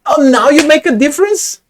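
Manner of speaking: loud, high outraged question; exasperated, high-pitched protest; indignant disbelief. Real, not acted. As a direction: call out the translator, incredulous and loud. Indignant at the translator's changed output.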